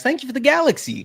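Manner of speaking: bright thank you. Real, not acted. Thanking a gifter on stream.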